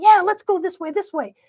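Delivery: high, loud cheer; rally everyone to follow; super excited. Acted.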